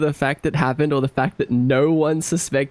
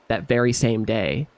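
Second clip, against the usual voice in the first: usual speaking voice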